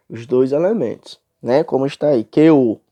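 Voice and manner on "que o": "que o" loud, as one joined syllable; vogais agregadas. Shown as a demonstration. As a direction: run "que o" together without a gap. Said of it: "nós lemos assim". ("que o" junto, numa sílaba só)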